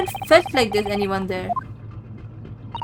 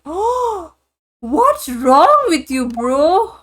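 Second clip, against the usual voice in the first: shocked offence; 5 semitones higher, 5 dB louder, much slower than usual. Real, not acted. Offended by the sailor's insult.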